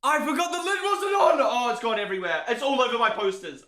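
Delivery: high-pitched yelp of dismay; real panicked outburst. Real, not acted. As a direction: cry out as it spills everywhere. Spills something over posters.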